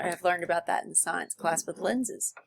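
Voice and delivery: ordinary talk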